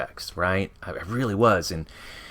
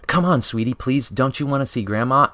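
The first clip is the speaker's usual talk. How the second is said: put-on higher, coaxing mom pleading with her kid; 6 semitones higher, 3 dB louder than usual. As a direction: sweet-talk the child, pitched up and pleading. Coaxing mother in comic panel.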